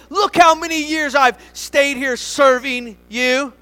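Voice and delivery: resentful complaint. Acted. Older brother complaining to his father, loud and aggrieved. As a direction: pour out years of grievance. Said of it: "The son said".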